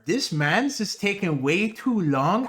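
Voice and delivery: mock Toronto roadman slang, dragged out; imitating the Toronto accent, slow and slangy. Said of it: "the ugly Toronto accent where they're like".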